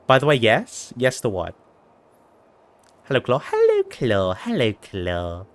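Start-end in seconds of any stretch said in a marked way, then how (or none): 3.5-4.3 very high squeaky greeting; squeaky high hello. Pretend. Greeting in a put-on voice. 4.3-5.5 slow, low, quiet drawn-out greeting; low slow hello. Pretend. Greeting in a put-on voice.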